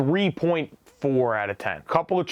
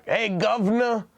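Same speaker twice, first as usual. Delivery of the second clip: bad British accent; 6 semitones higher than usual. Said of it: "I don't do British accents".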